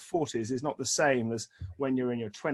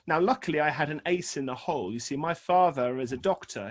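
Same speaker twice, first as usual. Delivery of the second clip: ordinary talk, nothing marked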